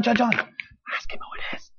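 urgent whisper, slow and hushed. Retold or imitated. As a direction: lean in and whisper it secretly. Peter secretly prodding John.

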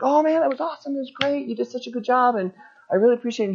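soft, high pep talk to a friend; mock encouraging. Cheering up a defeated friend.

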